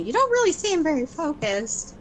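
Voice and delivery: lifted high, imitating what people say to her; mock quote of critics. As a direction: toss off others' remark in a borrowed voice. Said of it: "People say to me".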